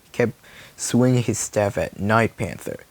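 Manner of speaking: plain reading voice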